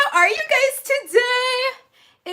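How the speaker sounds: high, loud, slow sing-song greeting; high loud slow sing-song hello; excited greeting. Real, not acted. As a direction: greet them with bursting energy.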